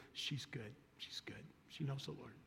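barely above a whisper, holding back tears; hushed tearful words. Retold or imitated. Granddaughter back at the car, hushed and tearful. Grieving girl's quiet report.

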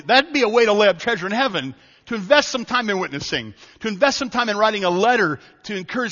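rally the congregation to act; full-force preaching. Acted.

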